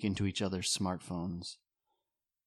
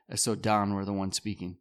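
ordinary talk, nothing marked

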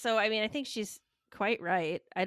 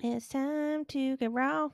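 announcer catchphrase; 8 semitones higher, much slower than usual. Acted. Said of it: "you know what time it is".

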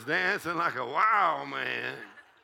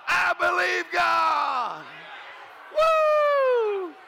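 higher, louder, slow shout; triumphant shout; 10 semitones higher, 4 dB louder, much slower than usual. Shown as a demonstration. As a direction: shout it out slowly. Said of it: "you just go".

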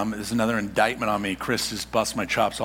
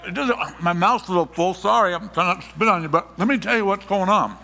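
lightly voiced mouth-full talker; 7 semitones higher, 3 dB louder than usual. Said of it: "they're like".

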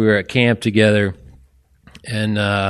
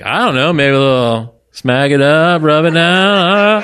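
mock friend; 6 semitones higher, 4 dB louder than usual. Comedian acting out his best friend's crude boast. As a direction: ham up the friend's bragging.

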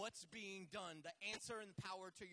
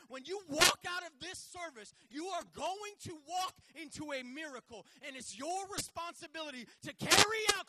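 loud, higher rallying preaching; preaching at full force; 10 semitones higher, 14 dB louder than usual. Acted. Preacher firing up the church to make noise. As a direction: build to a roaring call.

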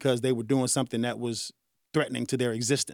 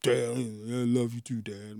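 put-on softer, slow mumbled kid reply, lower and dropping away; imitating kids; 5 semitones lower, 7 dB quieter, slower than usual. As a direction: lightly play a shy kid saying it back. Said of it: "You know, kids".

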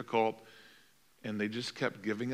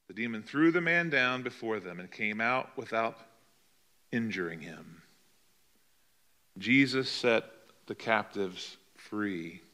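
normal talking voice

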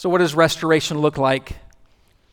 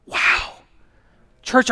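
usual speaking voice

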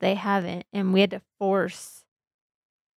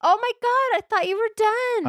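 exasperated; 13 semitones higher than usual. Real, not acted. Exasperated at friend.

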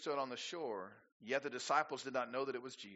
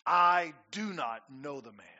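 cursing denial; 9 dB louder, slower than usual. Retold or imitated. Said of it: "Profanely cursed".

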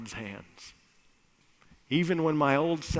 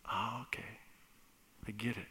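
quiet realization; 3 semitones lower, 12 dB quieter, much faster than usual. Acted. Son accepts quietly.

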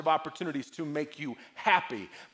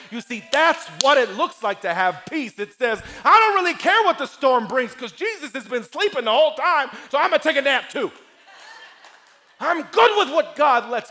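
loud, high, driving sermon delivery; full-force preaching; 6 semitones higher, 8 dB louder than usual. Acted. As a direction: build defiant confidence like a fired-up preacher.

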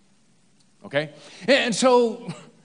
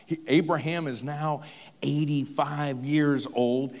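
usual speaking voice

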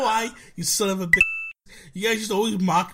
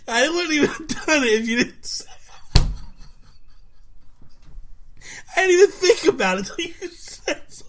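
higher, breaking into laughter; laughing while talking; 10 semitones higher than usual. Real, not acted. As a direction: talk through giggles. Laughing through words.

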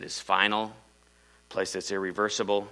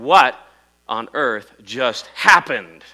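startled cry; 2 semitones higher, 10 dB louder, much slower than usual. Retold or imitated. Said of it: "leaving my body and saying".

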